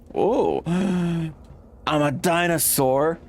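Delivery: gasping, high and drawn-out wonder; amazed gasp. Real, not acted. Player gasps at turning into a dinosaur, amazed. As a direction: marvel at becoming a dinosaur.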